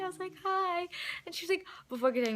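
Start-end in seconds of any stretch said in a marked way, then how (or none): 0.4-0.9 high little hi back; retelling a giddy high hello; retold starstruck reply. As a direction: echo the greeting, high but less squealed. Her own starstruck reply, retold.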